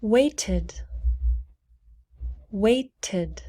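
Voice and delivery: "waited" in two syllables, ending in "tid"; extra syllable. Shown as a demonstration. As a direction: sound the final "tid" in "waited". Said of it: "so we pronounce tid".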